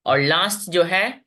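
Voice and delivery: ordinary talk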